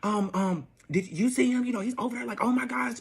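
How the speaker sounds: high, breathless gossipy whisper-talk; gossiping girls. Retold or imitated. Clubgoers nudging her about her ex, gossipy. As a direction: imitate nosy friends spotting him. Club gossips voiced in story.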